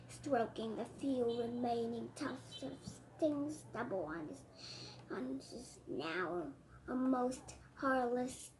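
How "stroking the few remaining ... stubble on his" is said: ordinary delivery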